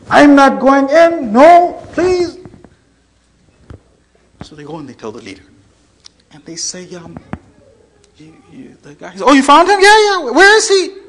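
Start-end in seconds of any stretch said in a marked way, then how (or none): 0.1-2.4 high, loud, drawn-out pleading; panicked refusal. Retold or imitated. Acted terrified man refuses to enter. 9.2-11.0 loud, high burst of eager questions; excited leader. Retold or imitated. Leader hears man was found.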